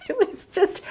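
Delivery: high, breaking into real laughter; words lost in a giggle; laughing. Real, not acted. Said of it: "I can't stop laughing about it".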